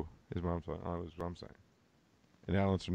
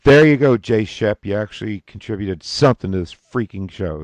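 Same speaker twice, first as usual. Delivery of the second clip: loud mock praise; 13 dB louder than usual.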